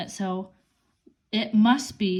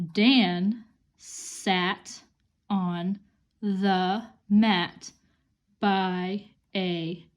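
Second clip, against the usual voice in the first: put-on slow, word-by-word reading for kids; reading slowly; much slower than usual. Shown as a demonstration. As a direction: read each word slowly and clearly.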